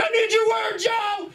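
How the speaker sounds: very high shouted plea; high mock scream at Joe; desperate yell. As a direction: scream it at Joe while brawling. Brawl demand in bit.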